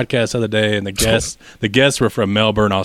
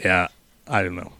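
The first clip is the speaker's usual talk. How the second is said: ordinary delivery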